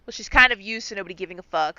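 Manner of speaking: normal talking voice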